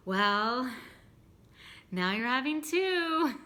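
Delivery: telling dad he's getting two granddaughters; teasing reveal. Retold or imitated. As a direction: savour the surprise for dad. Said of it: "And I was like".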